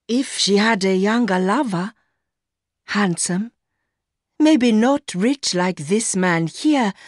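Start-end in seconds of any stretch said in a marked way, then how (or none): none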